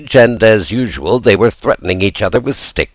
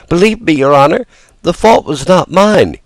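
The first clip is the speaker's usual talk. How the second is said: pleading fearful voice; 10 semitones higher than usual. Acted. Pinocchio pleads with director.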